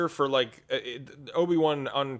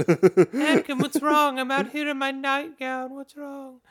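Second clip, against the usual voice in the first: higher mock Padme; 10 semitones higher, 3 dB louder than usual. Voicing Padme in her nightgown.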